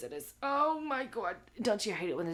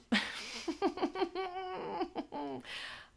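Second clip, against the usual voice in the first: high laugh; 11 semitones higher than usual. Real, not acted. Laughs at chat.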